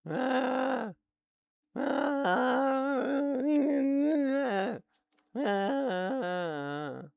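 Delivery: high, cracking cries of "ah"; high vocal cries testing a hoarse voice; strained high cries. Real, not acted. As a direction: test the failing voice with high shouts. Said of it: "I'm losing my voice".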